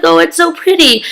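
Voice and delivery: high-pitched admiring coo; acted-out gushing. Viewer admires Elena's prison room, acted-out gushing.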